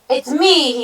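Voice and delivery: loud, high announcement; big goat declaring himself; grunted. A narrator's reading. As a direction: grunt it out boldly. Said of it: "he grunted".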